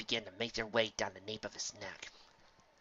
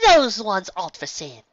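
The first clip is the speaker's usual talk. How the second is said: high, loud posh unicorn lady; prim fashion-mare voice; Rarity voice; 9 semitones higher, 12 dB louder than usual. Acted. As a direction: play the elegant shopkeeper mare, higher up. Rarity character voice.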